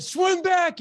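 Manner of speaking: high, very loud retold yell; coach's yell. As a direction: yell the order like an insistent coach. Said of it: "And he yelled at me".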